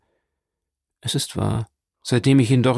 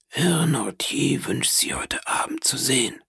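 whispering; 3 semitones higher, 4 dB quieter than usual. Acted. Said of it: "flüsterte er ihr zu".

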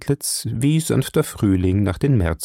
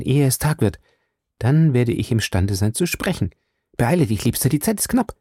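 hasty; 5 semitones higher than usual. A narrator's reading. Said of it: "Sie sagte hastig".

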